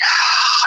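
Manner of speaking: loud, heavy acted-out sigh; imitated deep breath, let out audibly. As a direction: heave a big breathy sigh. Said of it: "he takes a deep breath".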